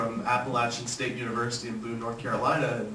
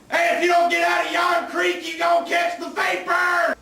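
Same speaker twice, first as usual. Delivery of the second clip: put-on high-pitched holler at the kids; sharp, high Southern shout; Southern parent yelling; 4 dB louder than usual. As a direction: break off and yell to children far away. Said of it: "telling his children to quit playing in the creek".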